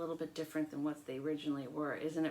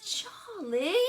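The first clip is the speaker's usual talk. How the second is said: higher, rising call to her brother; Lola calling Charlie; 10 semitones higher, 3 dB louder than usual. Acted. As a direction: sing out his name. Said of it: "she said".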